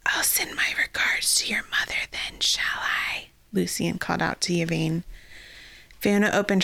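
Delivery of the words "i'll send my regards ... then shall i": whispered villain voice. Acted. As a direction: hiss it like an undead lich. Lich taunts Yvain.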